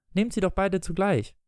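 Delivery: put-on high-pitched child's suggestion; eager girl. Wendy settles father and brother's standoff, high child voice.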